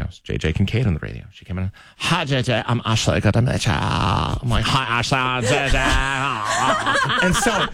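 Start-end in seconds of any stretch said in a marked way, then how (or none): 2.0-4.3 put-on drawn-out California Valley Girl vowels; Valley Girl accent. New colleague introducing herself to radio host, mock Valley Girl accent. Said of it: "the most basic of California accents". 4.6-5.8 exaggerated imitation of Valley Girl vowels; mock Valley Girl accent. Radio host greeting Ashley, copying her Valley Girl accent. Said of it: "I thought you were doing a character voice".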